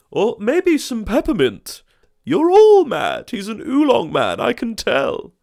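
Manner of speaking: very high, loud, slowed squabbling voice; shrieking neighbour. Acted. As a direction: screech it at the others, dead sure.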